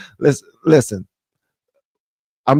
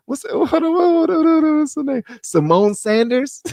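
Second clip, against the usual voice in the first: excited hold on; 12 semitones higher than usual. Real, not acted. Stops mid-sentence, recalling a name.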